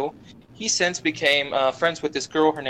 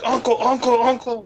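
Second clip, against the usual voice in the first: high-pitched rapid yelps of surrender; mock pleading; 8 semitones higher than usual. Storyteller mimics a bully with his hand twisted, mock pleading. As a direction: imitate someone squealing to give in.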